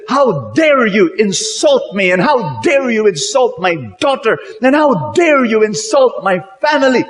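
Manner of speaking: rising fury, each how dare you harder; indignant outrage. Acted. Insulted father confronts suitor.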